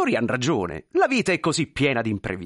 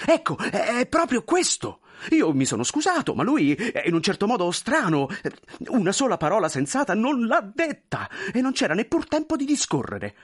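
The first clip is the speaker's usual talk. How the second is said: much higher, pleading, flustered voice; anxious clerk; 10 semitones higher, 3 dB louder than usual. Acted. Clerk pleading with the general, high and flustered. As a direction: beg forgiveness nervously.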